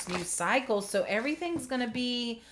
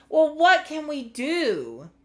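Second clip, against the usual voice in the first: higher, drawn-out helpless plea; louder, emotional appeal; a little emotional; 6 semitones higher, 6 dB louder than usual. Real, not acted. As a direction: ask it with rising, exasperated feeling. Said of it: "I'm getting a little emotional".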